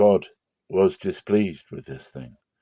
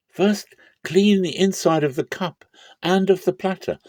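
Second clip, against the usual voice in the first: higher, forceful preaching denunciation; impassioned rebuke; 6 semitones higher than usual. Acted. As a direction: condemn the hypocrites with rising fire. Jesus denounces scribes and Pharisees.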